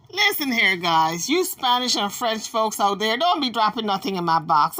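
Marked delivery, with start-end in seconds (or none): none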